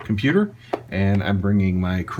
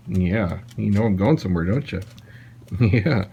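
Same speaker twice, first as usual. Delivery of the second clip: normal talking voice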